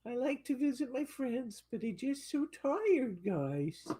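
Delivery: high, soft tired puppy voice; whiny sleepy pup; voicing the dog. Owner voicing the tired poodle to viewers, high and soft. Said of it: "He said".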